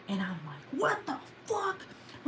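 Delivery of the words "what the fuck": mock outrage. Owner spotting runaway cat on grass, mock outraged squeal. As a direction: squeal it slowly like a held-back yell. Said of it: "I'm so fucking mad".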